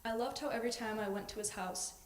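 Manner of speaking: normal talking voice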